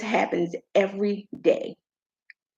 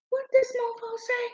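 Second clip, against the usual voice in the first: high, hushed incredulity; squeaky, muted outrage; retold disbelief; 12 semitones higher, 5 dB quieter than usual. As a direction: replay being stunned, high and soft. Retells disbelief at boyfriend's words.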